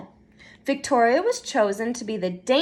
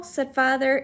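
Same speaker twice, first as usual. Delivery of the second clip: ordinary delivery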